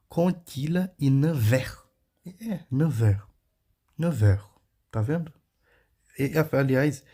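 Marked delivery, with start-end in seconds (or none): 0.1-1.8 "Contilha e neuf heures" slow and high, words linked; French liaison demo. Shown as a demonstration. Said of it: "elas fazem uma ligação". 2.7-3.2 "neuf heures" linked as neu-vër; French liaison demo. Shown as a demonstration. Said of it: "é o som do de". 4.0-4.4 "Neuf heures" run together as neu-vër; French liaison demo. Shown as a demonstration. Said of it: "é o som do de".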